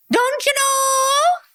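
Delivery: very high, slow Minnesota lilt; hammed-up Minnesota singsong; Minnesota accent. Mocking Barry's choice, exaggerated Minnesota accent. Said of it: "from Minnesota talking like that".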